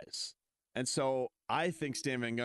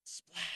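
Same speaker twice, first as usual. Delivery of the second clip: hushed, higher imitation of a splash; soft splash effect; 7 semitones higher, 8 dB quieter than usual. Co-host telling a boat tale, soft splash effect. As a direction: say it softly like a sound effect. Voicing sound in told scene.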